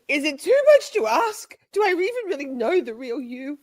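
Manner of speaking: high, plaintive melodrama; mock betrayal. Questioning friend who hid a secret, mock wounded. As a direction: ask as a wounded spouse, hammed up.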